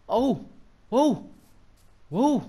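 short, loud, high yelps; startled amazement. Real, not acted. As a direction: yelp in wonder at each jump. Startled by the jumping panda.

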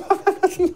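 high, scoffing chuckle; derisive laugh. Real, not acted. Host mocking his own naive question, derisive chuckle. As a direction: laugh at the very idea.

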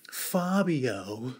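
ordinary delivery

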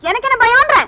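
heated defiant retort. Acted. Woman escalating her challenge to the man, heatedly defiant. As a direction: push the repeated question harder, pitched high.

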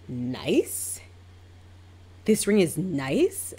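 slow, offended questions swooping up; mock-affronted client, drawn out; insulted disbelief. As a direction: echo it back as if gravely insulted. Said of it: "insulted that that's my reaction".

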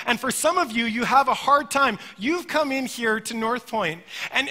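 full-force sermon delivery; fervent preaching. Acted. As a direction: preach it with rising urgency.

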